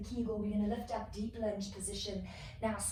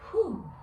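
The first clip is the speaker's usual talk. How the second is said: loud whew exhale; 5 semitones higher, 7 dB louder than usual. Real, not acted. Exhaling after the flow.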